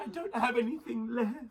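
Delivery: high, soft mock-crying whine; mock tearful. Teller imitates the girl short of a euro, high tearful whine. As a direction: imitate her squeaking it out through tears. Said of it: "And started crying".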